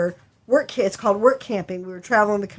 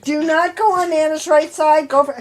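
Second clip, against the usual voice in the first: lecturing mom; 6 semitones higher, 5 dB louder than usual. Retold or imitated.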